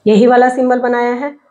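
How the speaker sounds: ordinary talk, nothing marked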